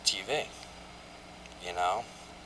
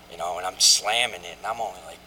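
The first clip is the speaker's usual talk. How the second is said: usual speaking voice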